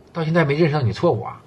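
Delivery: usual speaking voice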